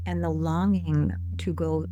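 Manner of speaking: unhurried, measured guided-visualization voice; guided meditation. Acted. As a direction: lead listeners gently inward, leaving space between phrases.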